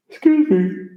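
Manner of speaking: high-pitched words through a real yawn. Real, not acted. Tired streamer excusing a late-night yawn to viewers, yawning. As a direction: excuse yourself mid-yawn. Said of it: "That's why I'm yawning".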